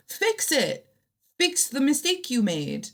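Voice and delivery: heated. Real, not acted. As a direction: get worked up and insist. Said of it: "I'm getting heated".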